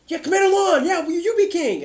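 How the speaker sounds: high, loud crowd cheering him on; eager townspeople. Acted. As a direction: squeal it out like a hopeful villager. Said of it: "they're like".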